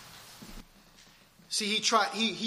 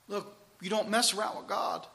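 normal talking voice

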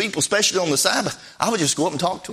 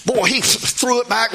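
usual speaking voice

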